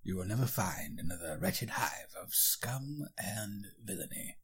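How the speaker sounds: put-on slow, hushed wise old mentor; Obi-Wan Kenobi. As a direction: whisper it like a wary old Jedi. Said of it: "Okay, Obi-Wan".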